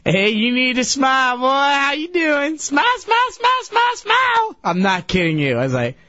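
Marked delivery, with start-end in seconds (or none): 0.0-4.6 mock high woman's voice, climbing higher and higher; older lady. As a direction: imitate a pushy barfly lady, rising to a squeal. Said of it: "this older lady comes up next to me".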